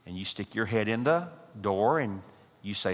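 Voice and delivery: usual speaking voice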